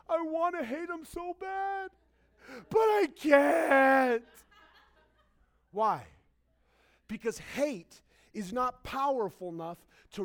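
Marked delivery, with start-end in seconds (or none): 0.1-4.3 mock breaking down. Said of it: "you just break down crying".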